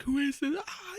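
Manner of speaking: high-pitched mock panic; freaking-out trooper. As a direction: imitate a panicked trooper, breath trailing into a gasp. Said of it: "like, freaking out".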